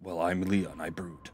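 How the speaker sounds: low, quiet mock-brooding hero; a hint of a moody hero; brooding Leon. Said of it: "that joke will still be funny".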